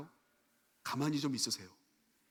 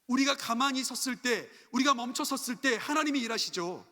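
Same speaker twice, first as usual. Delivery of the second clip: raised-pitch sermon proclamation; emphatic preaching; 8 semitones higher, 5 dB louder than usual. Real, not acted. Preacher telling the congregation God works when we stop, emphatic. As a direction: proclaim it firmly to the room.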